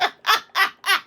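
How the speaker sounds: high giggle; high laugh. Real, not acted. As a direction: break into laughter. Laughing at her own joke.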